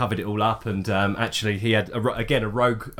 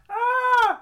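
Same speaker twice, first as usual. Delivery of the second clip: high-pitched mock scream; short, high, put-on "ah" of alarm; imitated scream; 3 dB louder than usual. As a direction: mimic a player's sudden scream. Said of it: "one of you screamed".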